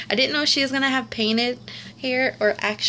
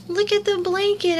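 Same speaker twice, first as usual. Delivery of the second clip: gasp, then higher and brighter; delighted gasp; 6 semitones higher than usual. Real, not acted. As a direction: catch your breath at the pretty blanket. Delighted unboxing a reborn doll.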